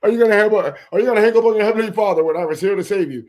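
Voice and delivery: ordinary talk, nothing marked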